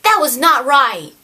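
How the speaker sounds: hammed-up telling-off; mock reprimand. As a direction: tell them off in play-acted indignation. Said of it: "like, reprimanded the Salarian the same way".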